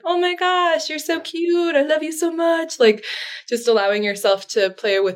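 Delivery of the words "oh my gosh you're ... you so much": put-on very high, gushing voice for a baby or dog; baby talk. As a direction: coo at a puppy like it's the cutest thing alive. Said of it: "like when you see a baby or a dog and you're like".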